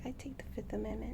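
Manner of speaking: fast whispered "I take the Fifth Amendment."; whispered bird voice. Retold or imitated. Owner voicing her bird pleading the fifth, whispered. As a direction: whisper the bird's smug refusal quickly. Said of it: "She's like".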